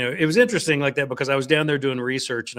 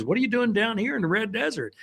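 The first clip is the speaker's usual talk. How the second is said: lightly voiced curious waitress, pitched higher; a hint of a startled small-town waitress; mild imitation of a waitress caught off guard; surprised waitress; 10 semitones higher than usual. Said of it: "And she goes".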